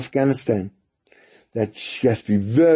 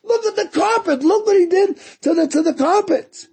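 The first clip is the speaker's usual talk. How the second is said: hammed-up hysterical mother; mother screaming. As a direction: wail at the ruined carpet. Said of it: "His mother shouts and, and screams".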